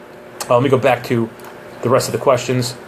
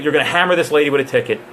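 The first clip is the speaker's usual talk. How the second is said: ordinary talk, nothing marked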